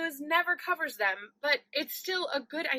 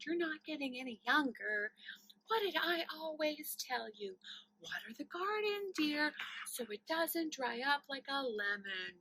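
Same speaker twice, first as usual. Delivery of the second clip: a quiet, softer mother's nagging; imitating mom; 10 dB quieter than usual. Daughter quietly reciting mom's nagging along with her on the phone. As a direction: softly mouth along with mom's familiar lecture. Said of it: "see if I could say it word for word along with you".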